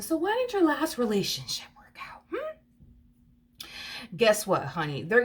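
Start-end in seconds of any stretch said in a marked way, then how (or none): 0.0-2.6 high, hushed whisper; whispered prying question. Retold or imitated. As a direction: lean in and whisper the nosy question. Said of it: "a common question to ask a person".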